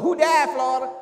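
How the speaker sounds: high, loud imitated shout of a busybody neighbour; the lady on Good Times. The lady on Good Times who always shows up, high shouted imitation. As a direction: belt it like the nosy sitcom lady. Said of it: "be like the lady".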